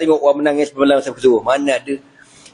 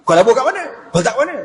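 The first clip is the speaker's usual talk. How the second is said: Umar questioning; 8 semitones higher, faster than usual. Acted. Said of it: "Lalu apa kata Omar?".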